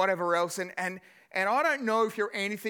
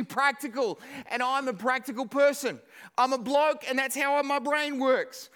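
louder, fired-up preacher's delivery; preaching at full force; 8 semitones higher, 5 dB louder than usual. Acted. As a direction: build the sermon with energy and punch. Preaching worked up.

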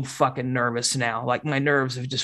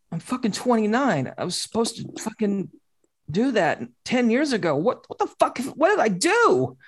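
rising, higher panicked self-quote; retold panic; 6 semitones higher than usual. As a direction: replay the moment it all hit you. Retold panic after breakdown.

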